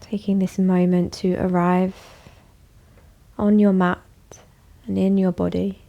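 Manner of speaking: calm, even voice with long pauses; guided meditation. Acted. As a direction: guide them gently inward, leaving space after each phrase. Leads a bedtime meditation.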